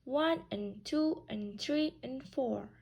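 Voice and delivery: "one" and "two" and "three" and "four" word by word; separate, choppy "one" and "two" and "three" and "four"; equal-weight counting. Shown as a demonstration. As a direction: give every "and" its own beat between "one", "two", "three", "four". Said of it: "I'm not saying".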